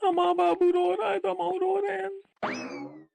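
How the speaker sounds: put-on high, childish tearful whine; crying. Chintua explaining to Amma why he cries, in tears. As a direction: blubber it like a crying little boy. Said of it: "कहे रोवत है तू?".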